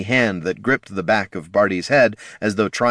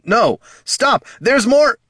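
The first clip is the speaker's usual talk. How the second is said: high, loud, desperate cries; frantic desperation; 6 dB louder, slower than usual. A narrator's reading. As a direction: yell to stop him, panicking. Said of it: "renewed his struggles with frantic desperation".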